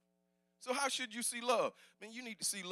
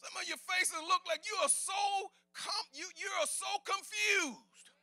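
full-force preaching; 11 semitones higher, 7 dB louder than usual. Acted. Pastor urges congregation to know God loves them, preaching at full shout. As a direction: preach it hard to the back pew.

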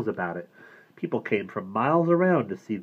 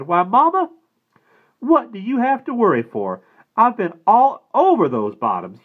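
put-on eager boy; 12 semitones higher, 9 dB louder than usual. A narrator's reading. Boy reassures mother.

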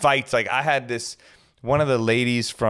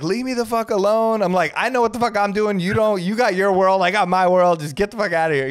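high, mock heated rant; retold telling-off; 9 semitones higher than usual. DJ to reality star, high mock heated rant. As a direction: tell her off like you finally lost it.

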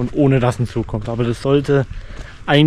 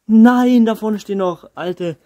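surprised cry; 7 semitones higher, 3 dB louder, slower than usual. Real, not acted. Shocked exclamation at old locos.

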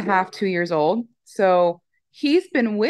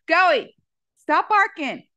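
higher, calling sharply to the dog; 8 semitones higher, slower than usual. Real, not acted. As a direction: tell the barking dog to stop.

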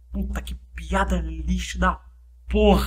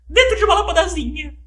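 squeaky tiny voice; 10 semitones higher, 6 dB louder than usual. Pretend. Continua a voz do gênio.

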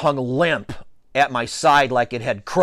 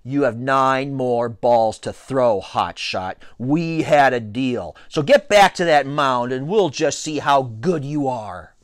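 plain reading voice

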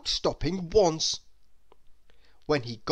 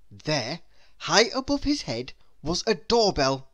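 ordinary delivery

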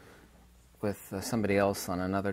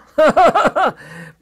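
loud, high-pitched real laugh; derisive laugh; 13 dB louder than usual. Real, not acted. Lecturer laughing at so-called scientists, openly derisive.

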